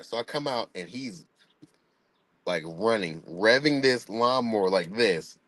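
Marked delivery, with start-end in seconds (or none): none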